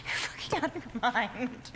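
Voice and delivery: ordinary delivery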